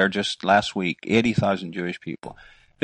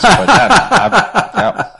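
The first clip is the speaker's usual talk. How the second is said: laughing; 14 semitones higher, 10 dB louder, slower than usual. Real, not acted. Joking reply about kosher donuts.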